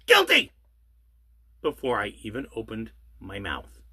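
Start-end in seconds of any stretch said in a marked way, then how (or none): 0.1-0.5 shouted high and loud like a gavel falling; mock judge verdict. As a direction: bark the verdict as a hammed-up judge. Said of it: "the activist judge would say".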